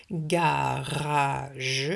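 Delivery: "garage" as long GA-RA-GE; slow "garage", final "je" sounded; syllables stretched. Shown as a demonstration. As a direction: draw out all three syllables of "garage". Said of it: "le couper en trois syllabes".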